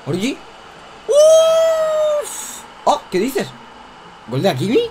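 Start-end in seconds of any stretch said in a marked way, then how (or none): 1.1-2.6 high far yelp of shock. Real, not acted. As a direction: yelp out in disbelief. Shock at the opponent's goal.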